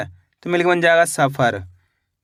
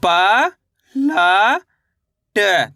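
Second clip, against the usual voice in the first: each letter drawn out, with a long pause after it; stretched, separated syllables; much slower than usual. Shown as a demonstration. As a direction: sound out the letters one at a time for learners. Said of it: "मेरे साथ साथ इनको repeat".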